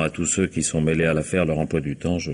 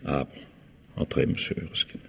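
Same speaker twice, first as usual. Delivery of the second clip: near-whispered, much softer; softly ushering the visitor inside; hushed; 3 semitones lower, 7 dB quieter, slower than usual. Acted. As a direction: let him in almost under your breath. Inspector lets visitor in.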